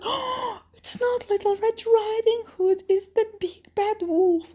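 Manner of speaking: very high, alarmed granny voice; shocked grandmother. Acted. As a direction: gasp it out high in play-acted shock. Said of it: "grandmother is shocked".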